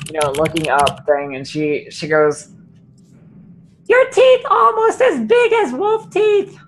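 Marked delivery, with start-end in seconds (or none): 3.9-6.6 high, slow, innocent compliment; Findira's voice. Acted. To the big-tusked orc guard, high slow admiration. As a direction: look way up at him and coo, slowly.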